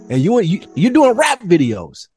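usual speaking voice

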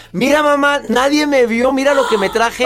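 put-on a kid proud of his loot; lightly voiced excited little boy; child voice. Child boasting to mother in story.

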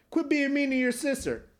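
high mock mother scolding; high scolding mom voice. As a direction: chide like an exasperated mom. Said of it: "his mom's just like".

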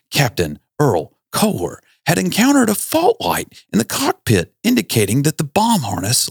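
high, formal news anchor read; newscaster voice. Shown as a demonstration. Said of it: "I'm gonna read it in my best newscaster voice".

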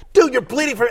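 high, loud retold protest; mock alarmed opponent. Retelling a film duel, alarmed opponent.

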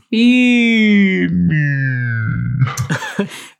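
long moan sliding down in pitch; slow drone like batteries running out; dying electronic toy. Acted. Imitating the Furby buried in the toy box, slow dying-toy drone. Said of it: "that slow voice of a dying electronic toy".

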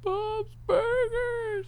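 very high, drawn-out squeal; silly high voice. Acted. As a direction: stretch it out in a tiny falsetto.

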